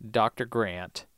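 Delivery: ordinary talk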